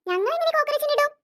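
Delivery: guilty mother pretending she's shocked; feigned innocence. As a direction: play innocent and indignant.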